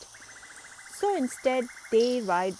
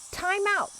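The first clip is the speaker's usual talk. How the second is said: out of breath; 12 semitones higher, 4 dB louder than usual. A narrator's reading. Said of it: "as he tries to catch his breath".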